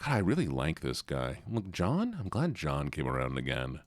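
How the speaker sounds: the dog at home with his family, mellow; calm relaxed dog. Retold or imitated. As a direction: lounge like an old dog by the fire. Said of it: "He's like".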